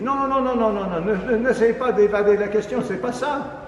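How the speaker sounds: voix bien plus haute et forte, en coupant la parole; imitation insistante. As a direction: refuse toute échappatoire, plus fort. Le jeune insiste, furieux. (le jeune compositeur imité, qui s'emporte)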